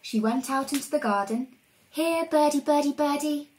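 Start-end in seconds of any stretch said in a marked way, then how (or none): 1.9-3.5 higher sing-song call to birds; coaxing call. Acted. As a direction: lure the little birds over. Coaxing birds to come closer.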